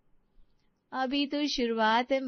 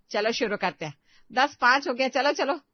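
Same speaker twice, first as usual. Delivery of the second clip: urging; 3 semitones higher, 5 dB louder than usual. Acted. Bossy mother-in-law hurrying everyone.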